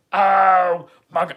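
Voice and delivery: loud slow oh my God; alarmed cry. Retold or imitated. Knocked to the ground by a drunk guy, loud cry.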